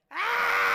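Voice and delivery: a high, shouted mock growl; Gray Panther growl. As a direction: let out a playful group growl. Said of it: "by doing the Gray Panther growl".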